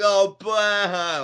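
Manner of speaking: loud fake sob sound; mock a crybaby heel; mock crying. Alexa mocking the fan with a fake cry, loud. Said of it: "And she's just like".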